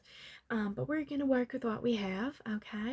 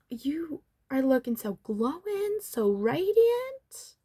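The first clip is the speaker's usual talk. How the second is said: drawn-out gushing, rising high on the last words; gushing flattery; 5 semitones higher, 5 dB louder, slower than usual. Pretend. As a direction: gush slowly, stretching the praise upward. Salon host flattering the client.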